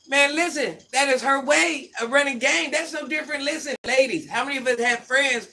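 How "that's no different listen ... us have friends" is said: ordinary talk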